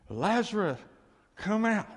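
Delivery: loud call. Acted. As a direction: call into the tomb with force. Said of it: "in a loud voice".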